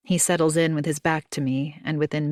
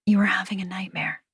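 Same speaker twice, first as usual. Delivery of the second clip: quick urgent whisper; fast hushed whisper; faster than usual. Acted. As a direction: hurry the lie out in a hush. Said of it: "I whisper".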